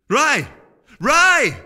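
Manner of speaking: calling out. A narrator's reading. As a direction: shout his name over the crowd. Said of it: "called above the bustle".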